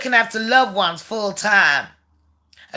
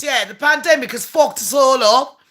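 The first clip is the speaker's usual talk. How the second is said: ordinary delivery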